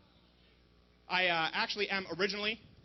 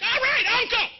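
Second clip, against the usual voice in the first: very high, loud mock squeal; hammed-up panicked yelp; bullied kid giving in; 14 semitones higher, 10 dB louder than usual. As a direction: cry out in surrender like a picked-on kid. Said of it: "He's like".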